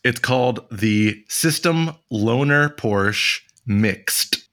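slow, slurred System Loaner Porsche Mixed; slow, careful repeat. Shown as a demonstration. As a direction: spell it out slowly. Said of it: "What's it called?".

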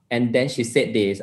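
usual speaking voice

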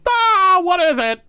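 high, loud character voice, drawn-out cry; Gorbo Borgo yelling. Acted. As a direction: holler it up high, put out at being disturbed. Gorbo appears, yelling at the disturbance.

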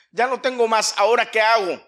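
high, loud acted appeal; insistent repeat. Warrior out of arrows asks what now, insistent.

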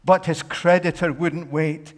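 high, loud dramatic preaching; storytelling at full force. Acted. Preacher acting out the parable, in a loud high telling. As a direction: tell it big and breathless, building.

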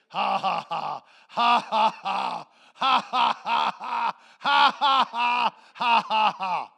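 booming, forced 'ha, ha, ha' laugh; Brother Hagin laughing. Retold or imitated. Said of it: "You know what Brother Hagin did?".

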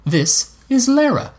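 put-on high boy's voice, slow introduction; Egg. A narrator's reading. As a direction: present someone in a high, drawn-out boyish voice. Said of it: "Egg began as he returned".

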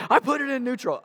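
very high-pitched mock yell; retold yelling back. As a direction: yell back, imitating the friend. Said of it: "we're yelling at each other".

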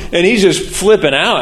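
ordinary talk

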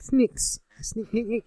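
high, skinny, soft New Zealand squeak; high Kiwi accent. Retold or imitated. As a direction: pitch it up like a little Kiwi. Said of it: "then New Zealand's like".

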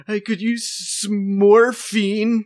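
high, loud, drawn-out mock plea; hammed-up injured performer. Hurt performer begging partner for morphine, high pathetic plea.

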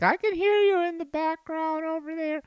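very high mimicked wife calling over; mock wife voice. Husband quoting wife who overhears his recording, mock falsetto. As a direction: mimic her in an exaggerated falsetto. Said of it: "I am making fun of you".

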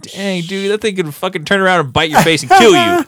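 high-pitched, building to a loud exclamation; mock alarm. Mock alarm at rattlesnake danger.

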